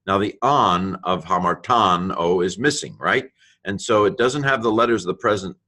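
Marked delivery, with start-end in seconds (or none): none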